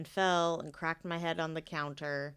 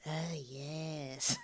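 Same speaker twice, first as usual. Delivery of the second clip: softer, eerie creepy voice; 2 semitones lower, 5 dB quieter than usual. Shown as a demonstration. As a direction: say it like a creepy ghost story. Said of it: "I used my creepy voice".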